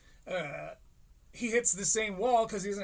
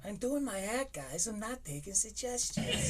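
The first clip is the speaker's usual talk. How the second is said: mimicking the comic; 3 semitones higher, 4 dB quieter than usual. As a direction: lightly parrot his defiant line. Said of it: "quoting the clip".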